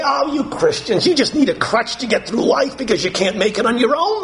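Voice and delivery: high, sneering mimicry of scoffers; mocking scoffers. Preacher imitating people who mock Christians, high sneer. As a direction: mimic the scornful critics. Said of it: "and say".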